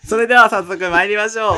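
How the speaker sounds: loud, high radio-host announcement; show opening call. Acted. Opening their freestyle radio show, a loud announcer call. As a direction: kick off the show like a DJ.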